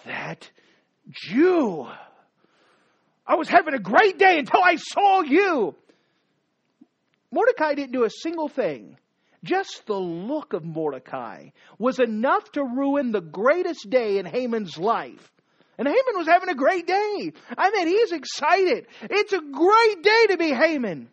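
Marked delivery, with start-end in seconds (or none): 1.1-5.8 angry shout. Acted. Preacher voices Haman's outburst. 15.8-21.1 excited. Acted. Preacher describes Haman's great day.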